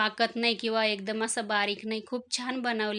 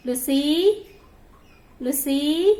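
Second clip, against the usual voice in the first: high, loud sing-song call of the cat's name; 5 semitones higher, 8 dB louder, much slower than usual. Real, not acted. Housewife calls her cat Lucy, high and loud. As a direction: call her from across the room.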